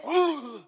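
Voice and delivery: a sharp frightened gasp, acted out; startled gasp. Sheep startled by the lion's roar, sharp mock gasp. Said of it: "the sheep goes".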